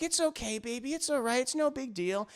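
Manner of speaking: softly forgiving, voiced a bit high; soothing girlfriend. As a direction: imitate a woman gently smoothing things over. Woman soothes her apologetic man.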